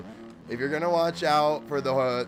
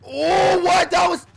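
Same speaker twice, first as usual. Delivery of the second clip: high, loud startled cry; shocked shout; 12 dB louder, slower than usual. Real, not acted. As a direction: yell in sudden disbelief. Sudden game surprise.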